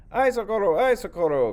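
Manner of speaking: high, loud panicked call; cry for help. Acted. The farmer fleeing the snake, crying for help. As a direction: shout for help as you flee.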